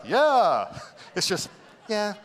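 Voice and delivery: a high, loud, eager "Yeah"; playful mimic of a man answering; mock eager yeah. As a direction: blurt it like a keen volunteer. Mocking a guy raising hand.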